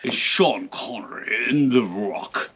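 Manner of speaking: low, soft, breathy Connery voice; lightly whispered Connery; Sean Connery impression. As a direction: murmur it like Connery.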